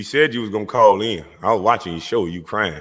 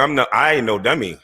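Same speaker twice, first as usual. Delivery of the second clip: ordinary talk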